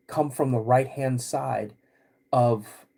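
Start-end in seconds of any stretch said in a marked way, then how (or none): none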